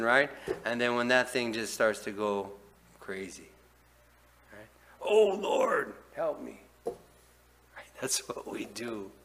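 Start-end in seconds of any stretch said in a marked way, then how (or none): 5.0-6.6 mock desperate plea.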